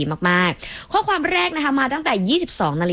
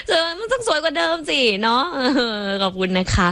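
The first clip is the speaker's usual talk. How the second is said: higher, giggling while talking; laughing through words; 7 semitones higher than usual. Real, not acted. DJ thanks the fan while still laughing.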